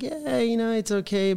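high, soft, dismissive imitation; noncommittal agreement. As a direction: imitate a friend placating, high and quiet. Voicing friends' half-hearted agreement.

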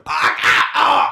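mock agonized cries; hammed-up gasping "ah"s, rising high; Tim Roth groaning. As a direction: cry out like you're bleeding in a backseat. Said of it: "Tim Roth in the backseat of a car going".

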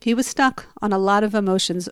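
usual speaking voice

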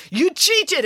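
very high, outraged charge; accusing. A narrator's reading. Man charges the nun with cheating, accusing. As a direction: fling the accusation out, pitched high. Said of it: "accused Ezio".

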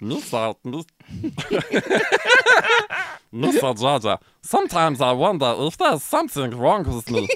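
goofy cartoon alien reading a romance; Jar Jar Binks. Reading erotic novel lines for a friend, Jar Jar impression. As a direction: put on a silly alien voice, breaking into laughs. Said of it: "to read in Jar Jar Binks' voice".

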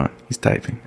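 hushed aside; whispering. Real, not acted. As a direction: breathe it under your voice. Waiting while guest types.

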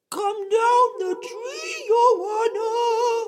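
high, loud, sweetened fake girl call; light airy voice. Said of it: "make his voice sound light and sweet".

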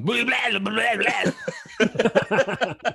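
high, loud, silly babbling cousin; goofy babble. Retold or imitated. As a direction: babble nonsense while flipping around. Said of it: "started doing backflips and going".

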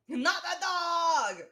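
high, drawn-out mock shout; slow, high shout in imitation; shouted protest. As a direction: yell it after a runaway dog. Acting Sophie's shout at the dog.

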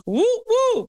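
high double "woo" cheer; little high-pitched cheer; celebratory whoop. Real, not acted. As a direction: whoop twice, high and playful. Cheering a topic of her series.

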